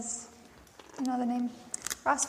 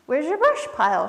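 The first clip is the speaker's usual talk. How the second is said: lightly squeaky chipmunk, higher and louder; perky storybook chipmunk question, pitched up; squeaking; 6 semitones higher, 5 dB louder than usual. A narrator's reading. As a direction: ask with a hint of a squeak. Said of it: "she squeaked".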